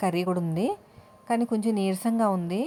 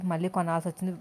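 ordinary talk

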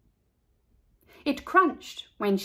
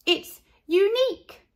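high, louder; proud girl; 10 semitones higher, 5 dB louder than usual. A narrator's reading. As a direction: insist proudly. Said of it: "said Carla".

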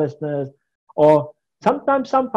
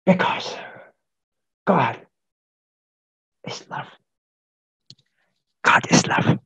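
whispering preacher; 2 semitones lower, 4 dB louder than usual. Shown as a demonstration. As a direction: whisper the sermon too faintly to hear. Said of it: "The whispering preacher".

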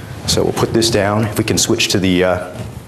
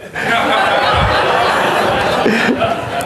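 breathy laugh. Real, not acted. Laughs at audience correction.

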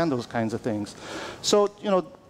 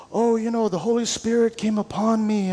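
higher-pitched mock church insider; church-insider talk; 5 semitones higher than usual. Pastor showing how believers talk among circles, church-insider talk. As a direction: lightly imitate pious insider chatter. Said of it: "we can talk like this among circles".

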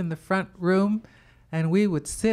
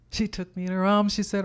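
ordinary delivery

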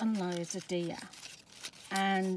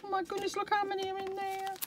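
high, delighted inner voice; gushing recalled thought; excited thought; 11 semitones higher, faster than usual. Retold or imitated. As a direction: replay the moment of discovery with glee. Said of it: "And I thought".

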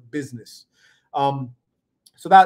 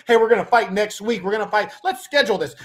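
higher-pitched mock scheduling voice; mocking, pitched-up appointment-making; mock eager planner; 6 semitones higher, faster than usual. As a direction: play an over-organized fighter, pitched up. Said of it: "it wasn't like".

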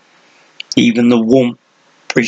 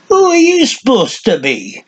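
put-on cranky old man; 12 semitones higher than usual. A narrator's reading. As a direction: sneer it like a crazy old hermit. Old man sees strangers.

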